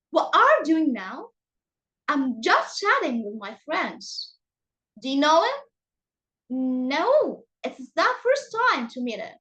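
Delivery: exaggerated swoops of pitch, like a teacher's demo; acted intonation. Shown as a demonstration. Teacher reading sample sentences for students to judge intonation, acted intonation. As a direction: act it out with big tune changes. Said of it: "After acting like this for you".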